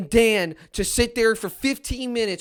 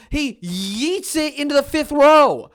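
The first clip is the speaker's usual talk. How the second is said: loud rant; 5 semitones higher, 5 dB louder, slower than usual. Real, not acted. Ranting at the play call.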